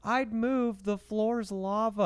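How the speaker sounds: put-on very high, drawn-out little-kid voice; high-pitched, slow child's warning. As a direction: warn him like a tiny child at play. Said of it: "like, this little voice go".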